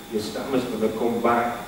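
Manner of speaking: low, quiet voice of doubt; a touch of the worried inner voice. Retold or imitated. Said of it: "I'm thinking".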